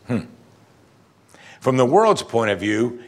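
ordinary talk, nothing marked

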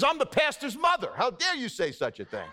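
indignant outrage. Retold or imitated. Pastor's mother scolding the usher, mock outrage. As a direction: play the affronted old lady, half laughing.

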